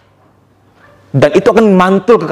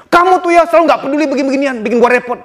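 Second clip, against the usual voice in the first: mock nagging wife; 7 semitones higher, faster than usual.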